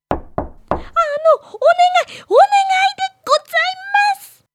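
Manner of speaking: put-on high pleading child voice; timid girl voice. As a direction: call out shyly for help. Girl voice calling at door.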